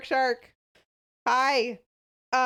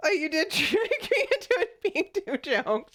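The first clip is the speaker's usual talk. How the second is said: laughing; 7 semitones higher, slower than usual. Real, not acted. Laughing at being tricked.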